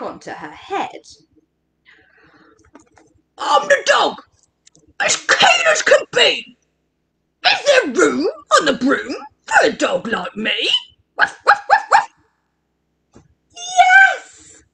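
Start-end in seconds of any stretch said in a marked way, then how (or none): 3.4-12.1 high, swooping dog character voice; eager dog. A narrator's reading. Dog asking the witch for room, eager high-pitched plea. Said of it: "eagerly said". 13.6-14.6 high, drawn-out witchy shout; witch's cry. A narrator's reading. Witch welcoming the dog aboard, high joyful cry. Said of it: "cried the witch".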